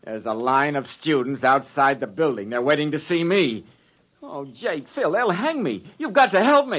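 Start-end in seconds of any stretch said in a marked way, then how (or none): 4.3-6.8 desperate plea. Acted. Scared publicity man begging colleagues, panicked. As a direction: cry out for help.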